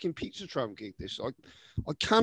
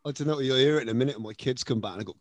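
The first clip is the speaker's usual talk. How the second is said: ordinary talk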